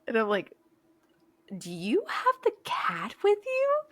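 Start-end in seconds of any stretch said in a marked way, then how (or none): 1.6-3.8 very high, slow mock disbelief; incredulous question. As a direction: stretch out an astonished question, pitched way up. Disbelieving question to mom, retold.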